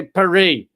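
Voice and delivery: "Paris" said the French way, pa-REE; Paris as the French say it, no final s. Shown as a demonstration. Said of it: "how they pronounce Paris in France".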